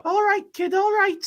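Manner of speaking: high, squeaky bad Scouse accent. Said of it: "these horrendous fucking, uh, accents".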